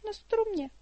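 put-on a small girl calls down from above; little girl's voice. A narrator's reading. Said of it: "odpověděl hlásek". (vysoký dívčí hlásek)

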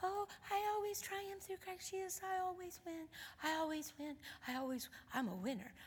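tiny high, very soft voice; timid little confession, barely voiced; real mousy. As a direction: squeak it out softly like a mouse. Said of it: "she started off real mousy".

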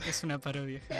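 usual speaking voice

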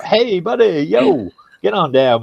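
high, overly pally bro greeting; imitating a too-casual bro; mock bro attitude. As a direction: ham up a fake-buddy hello. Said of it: "You know, none of that".

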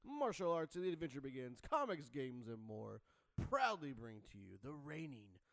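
booming ringmaster intro. Acted. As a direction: announce it like a circus ringmaster. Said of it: "such the, like, nineties late night guy".

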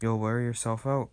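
ordinary delivery, nothing marked

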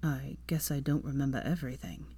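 tired man musing; Draco. A narrator's reading. Draco frowning.

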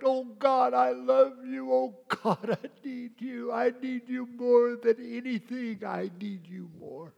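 high, loud impassioned prayer; preacher voices a worshipper's desperate cry; soul crying out. Acted. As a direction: cry out to God with longing. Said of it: "my soul crying out".

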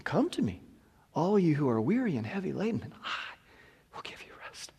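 soft whispered invitation; tender near-whisper; hushed. Acted. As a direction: breathe it gently like a comforting promise. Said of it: "the Prince of Peace is saying".

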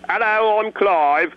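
chirpy Cockney hello; Cockney accent. Shown as a demonstration. Voice actor showing one of two Cockney brothers.

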